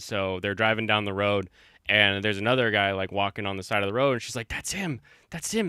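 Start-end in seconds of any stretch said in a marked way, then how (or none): 4.5-5.7 mock fearful. Girl in campfire story panics.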